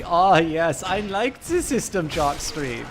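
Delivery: mock German accent, swooping high; Jessie Quill. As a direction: imitate a German woman, high with a slow finish. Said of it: "Jessie Quill is in fact German".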